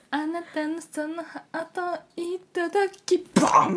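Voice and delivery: hammed-up idol catchphrase; idol performance voice. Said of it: "なんか". (野球部が[name]を真似たアイドル口調)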